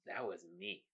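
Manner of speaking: low, hushed split-personality voice; quiet, ominous confession; deadpan denial. Acted. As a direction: answer very softly, deadpan.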